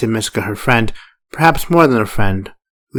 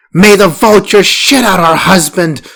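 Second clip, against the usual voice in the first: much higher, louder furious curse; 8 semitones higher, 7 dB louder than usual. A narrator's reading. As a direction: spit the curse out in rage. Farah curses husband furiously.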